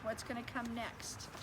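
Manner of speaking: usual speaking voice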